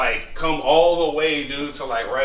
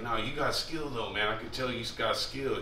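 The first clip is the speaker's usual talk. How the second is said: Harry's raspy voice; 4 semitones lower, 10 dB quieter than usual. Retold or imitated. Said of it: "he's always had that raspy ass voice".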